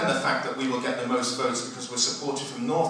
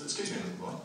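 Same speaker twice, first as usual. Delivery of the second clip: Osborne voice; 8 semitones higher, 7 dB quieter than usual. Retold or imitated. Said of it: "and he says".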